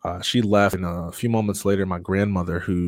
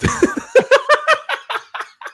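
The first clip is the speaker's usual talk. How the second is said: loud laughter; 7 dB louder than usual. Real, not acted. Laughing at his own story.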